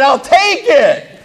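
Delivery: high, drawn-out eager claim; slow eager I'll take it. Retold or imitated. To the farm owner, slowly claiming the zebra. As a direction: savor the offer, stretched out.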